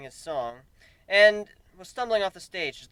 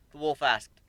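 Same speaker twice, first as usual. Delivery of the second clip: usual speaking voice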